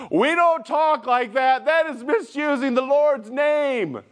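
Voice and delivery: put-on high, slow indignant child voice; scolding little kid. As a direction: announce it like a self-righteous kid. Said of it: "in their excitement".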